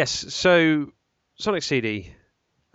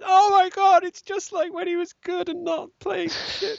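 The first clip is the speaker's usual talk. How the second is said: much higher, squealing fan voice; gushing fan. Retold or imitated. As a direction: squeal it like a fan reliving the golden age. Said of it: "playing and going".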